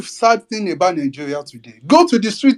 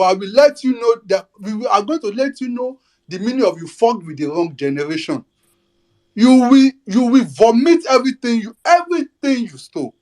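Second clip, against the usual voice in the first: angry warning; 5 semitones higher than usual. Real, not acted. Rising threat to rigging politicians.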